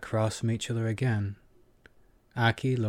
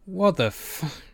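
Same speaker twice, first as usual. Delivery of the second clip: high-pitched, cut-off sputter of disbelief; 8 semitones higher, slower than usual. A narrator's reading. James caught off guard by Kiva's payment, sputtering. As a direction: start to curse and break off in shock. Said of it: "James sputtered".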